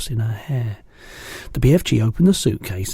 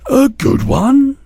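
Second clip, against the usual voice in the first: very high, loud, slow outcry; astonished; 8 dB louder, slower than usual. A narrator's reading. BFG answering Sophie's doubt, astonished outcry. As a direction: cry it back, amazed. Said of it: "he cried".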